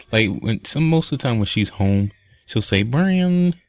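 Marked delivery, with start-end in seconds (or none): 2.8-3.6 high sing-song call of a name; Carly's voice. Retold or imitated. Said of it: "this is my Carly's voice".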